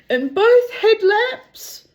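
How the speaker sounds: slow, high, projected question; loud captain's query. Acted. Captain checks the car with his mechanic, calling out slowly. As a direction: draw it out and call it loudly.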